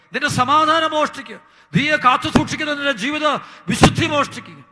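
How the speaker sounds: high, loud pulpit fervour; fiery preaching. Acted. Preacher warning the congregation about Satan, fiery preaching. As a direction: thunder it from the pulpit.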